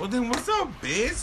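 high-pitched confrontational taunt; mock challenge. Cameron confronting the waitress over attitude, mock challenge. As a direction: throw it out as a high, cocky dare.

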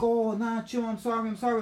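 high-pitched mimicry of her apologising; pleading apology. Woman caught smoking crack, high pleading apology.

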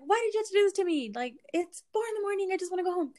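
high-pitched put-upon complaint; retold exasperated whine. Acted exasperated complaint at Danny.

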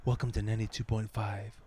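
slightly hushed radio welcome; mock radio DJ. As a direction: lightly imitate a sultry DJ. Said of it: "she changes her voice".

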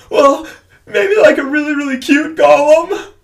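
very high, loud squeaky voice; mock tearful backpedal. New dad excusing himself to the doctor, comically tearful.

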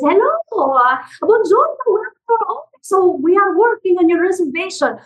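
high, lilting mock Italian accent; mother greeting an Italian client, in a hammed-up Italian accent; imitating an Italian accent. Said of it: "she would take on an accent".

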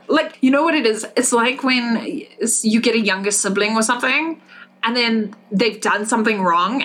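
normal talking voice

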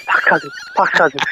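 breathless gasping words; hyperventilating. Retold or imitated. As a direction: gasp it out, can't breathe. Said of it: "like, hyperventilating".

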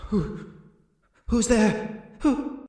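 high, halting captive voice; frightened captive. Acted. Game master as unseen prisoner calling out, timid. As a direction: call out uncertainly into the dark. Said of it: "Forgive my lack of good voice acting".

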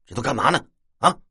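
略带不耐烦地质问; 带点火气地追问; 没好气. A narrator's reading. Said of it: "那酒糟鼻没好气地问". (没好气地问)

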